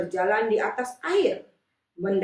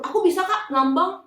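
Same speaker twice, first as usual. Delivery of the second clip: voiced child reply; 10 semitones higher, 5 dB louder than usual. A child answers the teacher.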